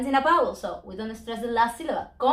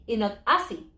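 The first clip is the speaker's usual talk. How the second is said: ordinary delivery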